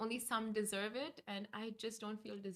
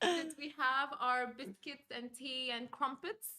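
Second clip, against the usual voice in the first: put-on English accent; 5 dB louder than usual. Shown as a demonstration. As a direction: ham up an English accent, drawn out. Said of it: "I'll just do the English accent that I know".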